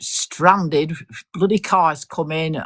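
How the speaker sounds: usual speaking voice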